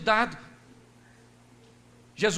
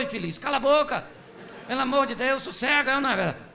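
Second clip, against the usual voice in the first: ordinary talk, nothing marked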